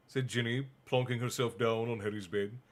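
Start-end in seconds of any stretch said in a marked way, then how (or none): none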